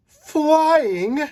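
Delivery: high, loud gleeful cry; flying high, yelling with delight; thrilled shout. Acted. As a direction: shout it as the ride drops. Scream on the roller coaster.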